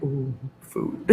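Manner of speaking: very soft, half-laughing mock craving; soft little food-lover's ooh; hushed craving. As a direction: murmur it like a retold moment of sudden hunger. Retelling reaction to hearing groceries.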